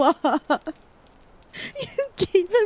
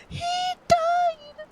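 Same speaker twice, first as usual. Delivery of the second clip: high, stunned cry of disbelief; he died, high and shocked. Real, not acted. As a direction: gasp it out in shock. Shocked at character death.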